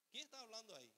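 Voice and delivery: ordinary talk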